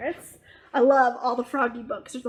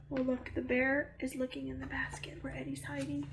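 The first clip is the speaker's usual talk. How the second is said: quick hushed whisper; suspenseful whispered aside; 13 dB quieter, faster than usual. Real, not acted. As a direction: whisper it like a secret. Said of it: "whispered aside to listener".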